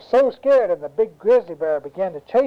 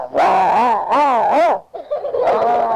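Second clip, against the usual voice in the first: loud howls of pain, climbing higher; stung bear howling; 3 semitones higher, 4 dB louder, much slower than usual. Acted. Storyteller plays bear stung on face and nose, howling. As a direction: howl repeatedly like a swarmed bear. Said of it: "began to sting the bear".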